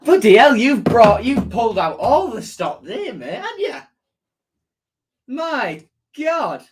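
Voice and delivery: loud, high burst of disbelief; astonished outburst. Real, not acted. Streamer to chat, stunned by a player's video message, loud astonishment. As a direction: gasp it out like you can't believe who's on screen.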